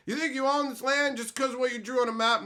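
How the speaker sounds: lightly hammed-up smug voice; mocking smug writer. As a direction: parody the preachy writer. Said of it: "So you fucking took a".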